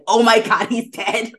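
loud, high mock disbelief; mock shocked spiral. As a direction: imitate an audience reeling in shock. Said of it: "full spiral of the audience".

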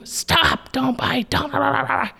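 high, sharp parental nagging; mock nagging scold. Shown as a demonstration. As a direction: nag the child with a sharp high stop. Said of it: "Adding more energy of you".

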